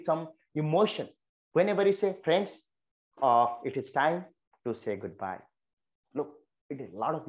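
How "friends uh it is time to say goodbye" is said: emotional goodbye. Shown as a demonstration. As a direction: let the feeling show as you part. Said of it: "You can feel the emotion, the way I speak".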